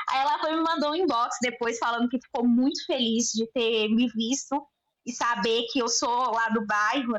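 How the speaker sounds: normal talking voice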